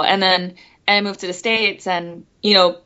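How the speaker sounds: usual speaking voice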